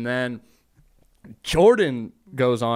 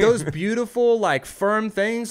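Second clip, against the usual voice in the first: mock admiring; 7 semitones higher, 4 dB louder, slower than usual. Mocking praise of breasts.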